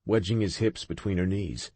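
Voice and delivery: usual speaking voice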